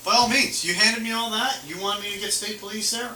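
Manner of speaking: high, loud mock offer, all sarcasm; sarcastic. Real, not acted. Officer mocking the driver's demand for state police, sarcastic and loud. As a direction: throw the demand back at him, sarcastically.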